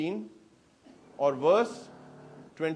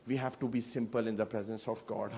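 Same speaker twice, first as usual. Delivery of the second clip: solemn and soft; 8 semitones lower, 10 dB quieter, much slower than usual. Real, not acted. Sermon's soft solemn point.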